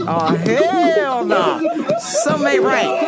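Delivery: loud, high laughing hell no; laughing loud protest; laughing disbelief. Retold or imitated. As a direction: crack up in disbelief. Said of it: "I'm like".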